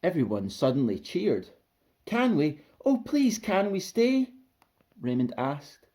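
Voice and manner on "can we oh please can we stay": put-on high, eager little-kid begging; pleading child. A narrator's reading. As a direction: beg your mother excitedly. Child begs mother to stay.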